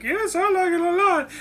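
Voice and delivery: high-pitched mock princess voice; high Leia voice. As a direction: squeak it out way up high. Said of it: "Leia's like".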